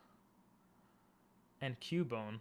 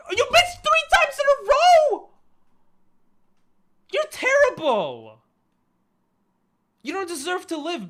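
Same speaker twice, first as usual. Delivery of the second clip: shrieking much higher and louder at the game; furious shout; 12 dB louder than usual. Real, not acted. Streamer yelling at her missing Pokemon, furious high shout. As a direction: scream at the character for missing again and again. Streamer raging at missed attacks.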